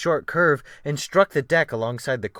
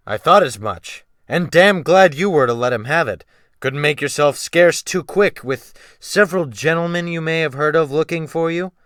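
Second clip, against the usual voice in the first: a touch louder, scornful captain; mocking captain; 5 semitones higher, 7 dB louder than usual. Captain jeering at the runaway boy, mild scorn.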